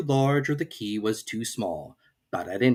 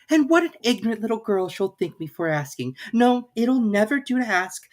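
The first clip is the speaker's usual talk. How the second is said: lightly voiced young girl, higher; Alice; 11 semitones higher than usual. A narrator's reading. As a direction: read Alice's lines a little higher and brighter in tone. Alice's musings.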